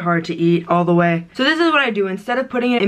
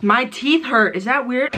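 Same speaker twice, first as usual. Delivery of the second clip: ordinary talk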